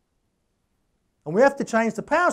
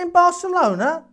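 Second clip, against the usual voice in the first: much higher, incredulous "In Barcelona?"; surprised question; 9 semitones higher, 3 dB louder than usual. Retold or imitated. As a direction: sound amazed at the city name. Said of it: "I said".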